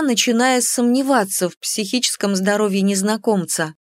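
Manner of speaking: ordinary delivery, nothing marked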